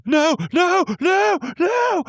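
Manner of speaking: high loud frantic no's; a mother hushing the dog in panic; imitating mom's panicked shout. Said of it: "I hear the mom going".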